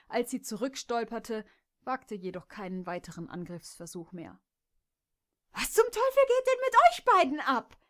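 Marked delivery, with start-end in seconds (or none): 5.5-7.8 much higher, loud, enraged cry; furious shout. Acted. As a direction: scream at them in outrage. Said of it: "wieherte Jess wutentbrannt". (Jess schreit die beiden Stuten wütend an, hoch und laut)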